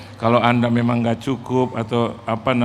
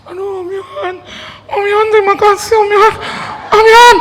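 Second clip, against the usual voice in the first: very high sobbing, building to a loud wail; imitated wailing thanks; 9 dB louder than usual. Pastor thanks the donor, hammed-up wailing. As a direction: cry out the name, louder each time, hammed-up. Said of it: "saya lihat dia nangis".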